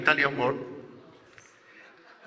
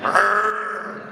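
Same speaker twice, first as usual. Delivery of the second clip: higher-pitched imitated bark; louder answering bark; 4 semitones higher than usual. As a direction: bark back like a dog. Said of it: "we bark louder".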